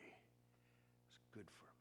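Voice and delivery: slow, breathy whisper to himself. Real, not acted. Pastor whispering his lesson from a brutal church, hushed. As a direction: let it fall to a whisper. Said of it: "I've said many times".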